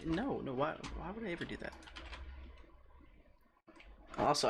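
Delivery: ordinary talk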